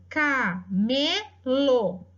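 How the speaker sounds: camelo stretched very slowly, syllable by syllable; slowly sounded out. Shown as a demonstration. Teacher sounding out camelo very slowly for the class. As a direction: draw out camelo for the class to hear each syllable.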